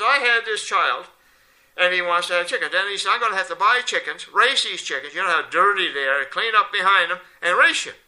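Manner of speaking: put-on loud, exasperated priest retelling the boy's plan; building into a loud, incredulous rant. Priest to rabbi, retelling the boy's complaint, loud and worked up.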